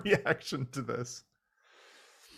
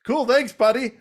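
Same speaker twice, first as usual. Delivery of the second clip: high, loud breezy cartoon voice; mock casual thanks; 12 semitones higher, 10 dB louder than usual. Prime tossing off breezy thanks, high and loud. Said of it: "He's like".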